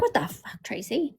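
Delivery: under-the-breath quoted aside; hushed mock disbelief. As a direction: drop to a near whisper, as if appalled. Retold reaction to a gross video.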